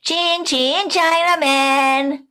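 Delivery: high, loud, slow jeering chant; taunting shout. Retold or imitated. Boys on bikes jeering at the girl, loud and slow. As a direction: taunt her like the boys on bicycles.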